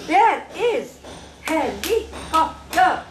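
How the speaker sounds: "There is helicopter" slowly, each syllable set apart. Shown as a demonstration. Teacher shows child how to say it, syllable by syllable. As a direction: model "There is helicopter" in slow, separated beats. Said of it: "do exactly what I do".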